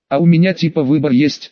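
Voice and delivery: ordinary delivery, nothing marked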